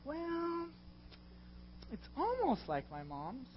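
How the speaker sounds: high, drawn-out fussy judgment; mock a picky eater, slowly; fussy critic. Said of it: "I've had the tendency of being like".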